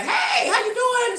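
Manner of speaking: very high, slow sugary hey; sweet fake greeting. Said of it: "I should be like".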